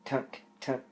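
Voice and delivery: soft creeping tunk tunk tunk; sinister tunk. Acted. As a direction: hush it like sneaking footsteps. Spooky carrot footsteps sound.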